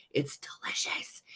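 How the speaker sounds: whispered rave; delighted whisper. Real, not acted. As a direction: drop to a hushed, savouring whisper. Savouring praise of brine mix.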